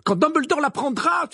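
high, loud threat; crying out. A narrator's reading. Professor warns the minister about Dumbledore, crying out. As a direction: shout the warning, pitched right up. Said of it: "s'écria le professeur McGonagall".

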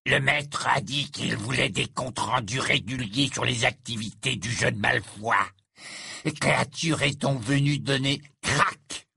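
put-on creature voice, altered timbre; servile house-elf reporting. A narrator's reading. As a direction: report like a goblin-like servant. Narrator voices the house-elf Créature.

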